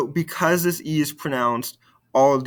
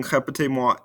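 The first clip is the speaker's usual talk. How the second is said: normal talking voice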